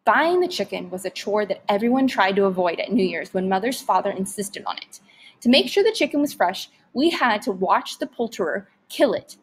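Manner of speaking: ordinary delivery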